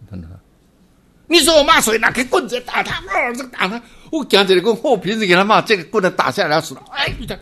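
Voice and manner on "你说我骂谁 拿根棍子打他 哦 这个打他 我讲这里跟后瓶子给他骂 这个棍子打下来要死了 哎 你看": high, loud furious shout; mock angry master. Master yelling at a disciple before striking him, loud mock rage. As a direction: roar at the student as if raising the stick.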